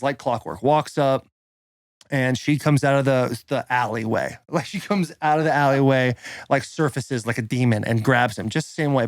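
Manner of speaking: ordinary delivery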